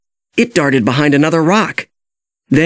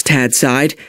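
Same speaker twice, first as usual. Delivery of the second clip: ordinary delivery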